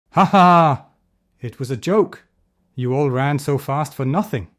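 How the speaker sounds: loud cackling laugh, then teasing words. Acted. Leo laughing at tired villagers he tricked. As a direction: laugh at them for being fooled. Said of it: "Leo laughed".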